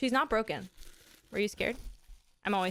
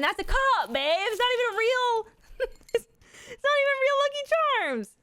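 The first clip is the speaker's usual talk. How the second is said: mock outrage; 13 semitones higher, 4 dB louder than usual. Real, not acted.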